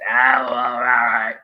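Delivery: slow, lightly voiced mumbled grumble of a clerk; odd movie mumble. Fan retelling the counter guy's strange noise, slow lightly voiced mumble. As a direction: drag it out like a mildly baffled clerk. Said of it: "that's the noise he makes".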